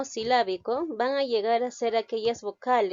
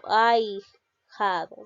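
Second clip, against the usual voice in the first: Ahijado drawn out slowly, syllable by syllable; slow demo word; 4 dB louder, much slower than usual. Shown as a demonstration. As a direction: stretch out Ahijado slowly for the class. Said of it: "pero sí lo escribo". (Ahijado pronunciado despacio, sílaba por sílaba)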